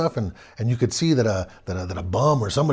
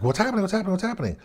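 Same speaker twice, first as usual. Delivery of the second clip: alarmed questions; 9 semitones higher, much faster than usual. Retold or imitated. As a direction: press them anxiously, much higher. Narrator alarmed at scared friends.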